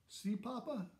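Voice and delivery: a hint of a little boy showing off; small boy voice. A narrator's reading. Son showing his father his throw, lightly childlike.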